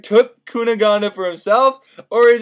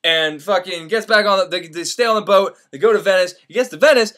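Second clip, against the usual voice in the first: ordinary talk, nothing marked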